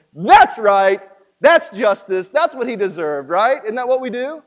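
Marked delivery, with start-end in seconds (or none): 0.1-3.3 louder, self-righteous chorus voice; smug crowd. Retold or imitated. Preacher voices the congregation cheering the punishment, loud and smug. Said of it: "And everybody goes".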